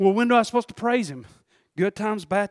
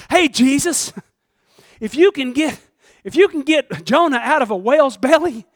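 raised, higher voice calling up to Jesus; loud, high acted plea; 5 semitones higher, 7 dB louder, slower than usual. Acts Jonah-like plea to Jesus.

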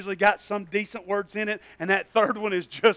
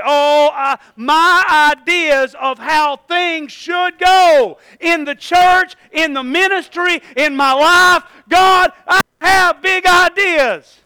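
high, loud, slow drawn-out boast; grand boast; 9 semitones higher, 14 dB louder, much slower than usual. Pretend. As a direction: swell with big ideas. Mocking own grand ideas.

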